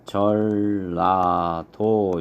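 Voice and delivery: 전라도 said slowly as 절라도; liquidized pronunciation. Shown as a demonstration. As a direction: stretch 전라도 into "jeol-la-do", syllable by syllable. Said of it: "그래서 발음을 하면".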